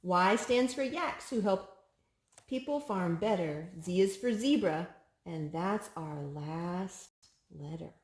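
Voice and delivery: lilting picture-book read-aloud; sing-song storybook reading; reading to a child. Acted. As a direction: read each rhyme brightly to a toddler.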